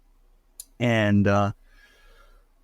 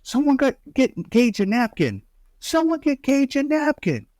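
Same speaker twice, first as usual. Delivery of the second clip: frantic Adam; 14 semitones higher than usual. Retold or imitated. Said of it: "Adam's like".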